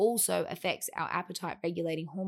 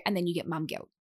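ordinary talk

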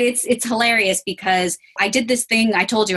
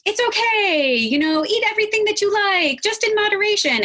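much higher, sweet and easygoing reassurance; soothing permissive voice; 13 semitones higher than usual. Retold or imitated. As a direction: coo it like everything is fine. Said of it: "my philosophy went from being like".

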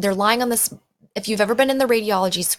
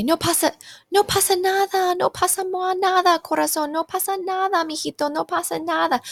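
higher, cooing "no pasa nada" over and over; soothing parent; 8 semitones higher than usual. Retold or imitated. A parent soothing a child under the X-ray machine, in higher cooing Spanish. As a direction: hush a scared child in Spanish, lightly voiced. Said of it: "Their parents oftentimes say".

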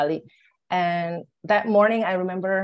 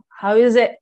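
louder, higher demanding question; upset outburst; 5 semitones higher, 9 dB louder than usual. Retold or imitated. Mother questioning the doctor, louder and upset. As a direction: blurt it out, upset and baffled. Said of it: "completely turned me into".

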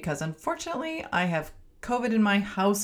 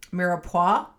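French accent; 3 dB louder than usual. Shown as a demonstration. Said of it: "make fun of my high school French, French, uh, accent".

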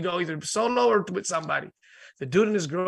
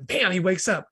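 usual speaking voice

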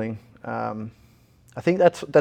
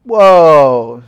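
shocked; 4 semitones higher, 12 dB louder than usual. Retold or imitated. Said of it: "And I was like".

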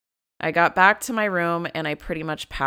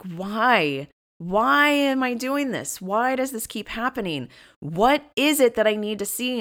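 high, stretched-out exasperated whys; vocal, angry questioning; 6 semitones higher than usual. Retold or imitated. Woman retells demanding answers from herself, angry. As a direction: plead for an answer, pitch swooping up. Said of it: "be vocal and ask".

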